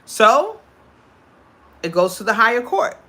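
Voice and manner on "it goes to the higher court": normal talking voice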